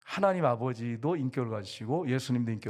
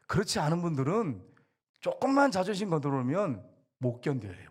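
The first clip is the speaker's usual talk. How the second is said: ordinary delivery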